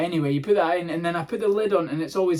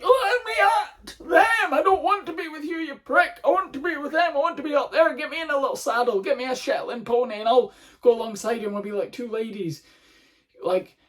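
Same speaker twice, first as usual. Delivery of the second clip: high, squeaky dog voice, fading down; eager little dog; 7 semitones higher than usual. Acted. Dog straining on the lead to reach the horse, high squeaky pleading. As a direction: squeal like a dog desperate to join.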